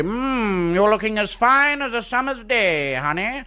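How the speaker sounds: high, hammed-up courtly flattery; mustache-twirling flirt; old-time gentleman suitor. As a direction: lay on mock old-fashioned charm. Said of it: "And you say".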